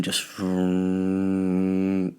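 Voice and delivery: low, soft car engine vroom. Retold or imitated. Imitating a drive-by car rolling past slowly. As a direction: growl it like a slow car cruising.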